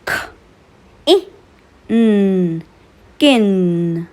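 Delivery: k, a, p, then blended into cap; sounding out words. Shown as a demonstration. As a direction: say each letter sound with pauses, then blend. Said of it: "Let's practice and blend the sounds".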